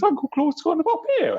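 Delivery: very high-pitched character voice; Uncle Claude. Shown as a demonstration. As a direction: push the voice right up into a high register. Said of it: "They speak at different registers".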